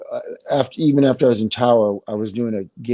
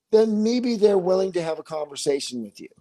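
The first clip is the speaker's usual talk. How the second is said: ordinary delivery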